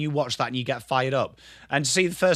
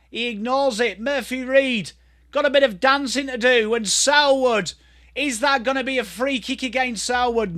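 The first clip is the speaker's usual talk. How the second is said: raised-pitch running footy call; live play-by-play; 7 semitones higher than usual. Real, not acted. Commentator following Murphy Reid's run, high and animated. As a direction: commentate each touch with rising energy.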